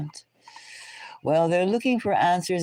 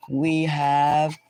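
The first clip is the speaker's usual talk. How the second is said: ordinary delivery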